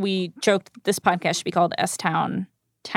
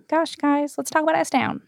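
playful Southern Texas drawl, pitched higher; mock Texas accent; 7 semitones higher than usual. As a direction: put on a hammed-up Texas twang for fun. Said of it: "it's my Southern, that's my Texas Southern accent".

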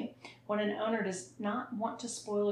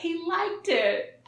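higher, slow laughing insistence; laughing, slower; 9 semitones higher, 4 dB louder, slower than usual. Real, not acted. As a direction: giggle while insisting. Repeated defence of story.

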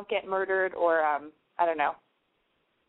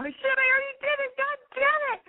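high squeaky mock exasperation; comic high-pitched annoyance; squeaky frustration. Real, not acted. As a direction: squeal at your own slip. Catching her own um habit.

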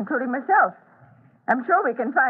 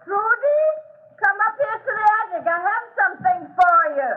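much higher, distant calling; calling from afar; 13 semitones higher than usual. Acted. Old woman calling the children up to the attic, high and far off. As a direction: call them up from the attic.

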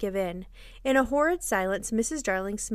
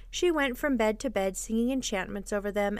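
usual speaking voice

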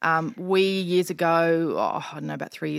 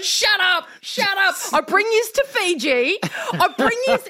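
high, loud hammed-up protest; mock tearful anger; 13 semitones higher, 4 dB louder than usual. Crying dad to kids in Fiji, mock tearful anger. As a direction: imitate an upset dad losing it. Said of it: "when he's crying".

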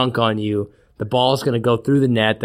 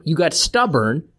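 ordinary talk

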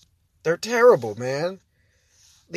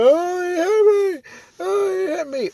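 much higher, slow hammed-up cry; fake victim wail; 4 dB louder than usual. Vlogger mocking a Karen faking a fall, hammed-up wail. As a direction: overact a Karen faking injury. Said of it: "fall down and scream and pretend".

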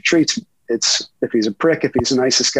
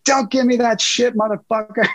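high, sharp yelled retort; a player yelling at the reporter who hints he'll still print his words; imitated yell; 8 semitones higher than usual. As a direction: bark it back at him in mock anger. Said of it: "and he's yelling".

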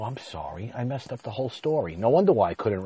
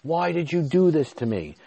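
higher and louder, a retold plea; anguished cry to God; 8 semitones higher, 4 dB louder than usual. Covered in paint, he retells yelling at God in anguish. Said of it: "I'm screaming at God".

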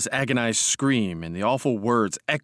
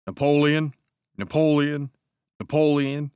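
reading titles; 5 semitones higher, 4 dB louder than usual. Acted. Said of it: "he read".

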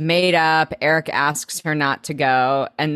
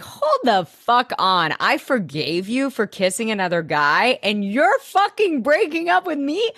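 indignation climbing steeply in pitch; outraged rant; 6 semitones higher than usual. Retold or imitated. Nate being dumped by S, escalating outraged rant. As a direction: build from disbelief to a high squawk of outrage. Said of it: "That's what I wanted him to scream".

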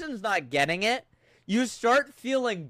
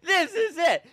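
laughing; 8 semitones higher, 4 dB louder than usual. Real, not acted. Laughing delight.

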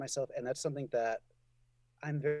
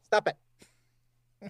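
mock stern command; 6 semitones higher, 6 dB louder than usual.

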